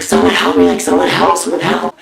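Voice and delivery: loud panicked scream for help. Retold or imitated. Teen with finger in car door screaming for help. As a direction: yell it out in full panic. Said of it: "So I scream".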